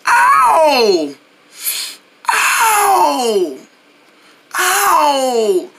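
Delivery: long, falling ow; cries of pain. Acted. Cat hurt after falling, drawn-out pained howls. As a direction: wail each ow, sliding downward. Whiskey falls while running.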